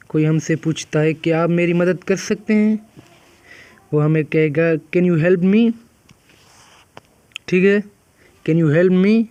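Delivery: ordinary talk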